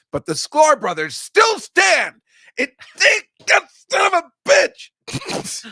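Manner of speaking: slow, high, loud mock wail; mock outrage. Joking about the Sklar brothers outliving everyone, mock outraged wail. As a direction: howl it like a comic betrayed by fate.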